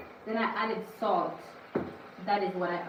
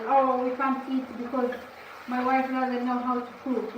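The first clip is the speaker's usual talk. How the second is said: louder, raised mimicking of an excuse; mock complaint; 5 semitones higher, 5 dB louder than usual. Husband explaining why nobody can eat, in mock complaint. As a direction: voice a complainer's excuse, pushed up in volume.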